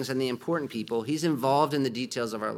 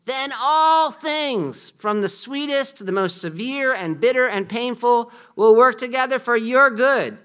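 rising, louder preaching that climbs higher toward the end; preaching at full force; 5 semitones higher, 6 dB louder than usual. Acted. Preacher reading Piper to the congregation, building to full force. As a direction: build the quote into a ringing proclamation. Reads John Piper quote aloud.

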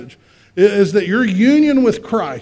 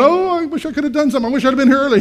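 high, fretful mock lament; helpless regret; 6 semitones higher, much faster than usual. Preacher mimics what Jesus is not saying, mock regret. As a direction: wail it higher and faster, as if too late. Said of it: "He's not going".

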